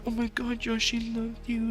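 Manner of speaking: high, gushing imitation of a friend. Friend Abby gushing that the baby loves Josh, high and cooing. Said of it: "She's like".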